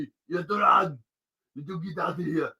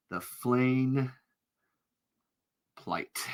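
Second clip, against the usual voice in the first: softer, drawn-out savoring; savored quietly; 5 dB quieter, much slower than usual. Shown as a demonstration. Naming a tabletop spell, savored quietly. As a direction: let it land softly. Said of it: "That was beautiful".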